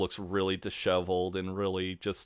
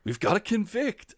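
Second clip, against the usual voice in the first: mock juror; 12 semitones higher than usual. Mock jurors in Twelve Angry Men.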